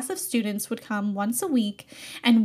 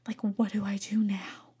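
soft unvoiced whisper to herself; whispered question; 3 semitones lower, 7 dB quieter than usual. Vlogger wondering what next after the class, whispering. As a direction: breathe it out, lost and wondering.

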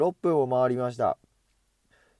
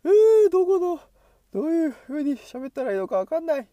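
much higher, strained voice; 11 semitones higher than usual. Shown as a demonstration. Vlogger experimenting with talking in a much higher voice. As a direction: talk way up in a high register. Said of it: "高い声で喋ってみるとこんな感じか".

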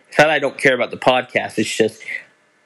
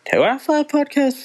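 sudden surprise; 14 semitones higher than usual. Real, not acted. Podcaster doubting his own podcast count, surprised. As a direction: pitch jumps way up in real surprise.

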